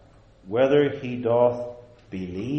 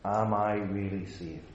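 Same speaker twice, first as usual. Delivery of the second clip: "Am I really saved?" low and soft, evenly weighted; varied-intonation self-questioning; 4 semitones lower, 7 dB quieter than usual. Shown as a demonstration. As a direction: ask "Am I really saved?" quietly, low, falling at the end. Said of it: "emphasize each word and change your intonation".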